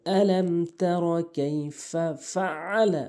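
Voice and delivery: slow recitation, ফাআলা with a mid-throat ain; correct ain recitation. Shown as a demonstration. Teacher modelling the verse, throaty ain in ফাআলা. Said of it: "আইনটি হলকের মাঝখান থেকে".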